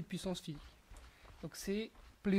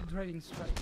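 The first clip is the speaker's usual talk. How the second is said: "Driving Strike" said with an English accent; name "Driving Strike" the English way, as a French player would; slower than usual. Real, not acted. Gamer naming Hercules' skill to viewers, English accent. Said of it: "Excusez mon accent anglais".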